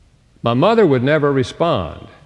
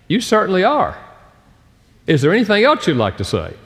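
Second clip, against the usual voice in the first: sarcastic retort; 7 semitones higher than usual. Retold or imitated. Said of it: "the other person may well be thinking".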